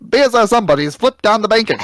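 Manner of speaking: high-pitched vintage broadcast call; old-timey race announcer. Pretend. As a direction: call the wreck like an old newsreel. Mimics old sped-up race broadcast.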